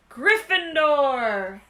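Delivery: slow, loud shout; hat shouting. A narrator's reading. The hat sorting Ron, slow loud shout. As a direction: stretch the house name out loudly. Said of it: "the hat had shouted".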